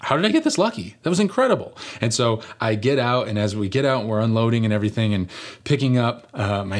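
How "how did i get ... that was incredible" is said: high, delighted inner voice; thrilled disbelief. Retold or imitated. Pastor recalling his parking luck, high and thrilled. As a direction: gush over the lucky parking spot. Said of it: "And I think".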